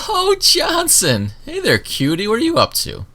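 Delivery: high, drawn-out flirty greeting. Acted. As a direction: coo at him like a fond old guard. GM voices the flirty guard.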